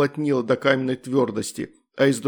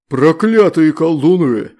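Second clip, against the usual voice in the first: much louder, slow monstrous outcry; demon exclaiming; 3 semitones higher, 9 dB louder, slower than usual. A narrator's reading. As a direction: bellow the curse like a demon. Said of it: "воскликнул он".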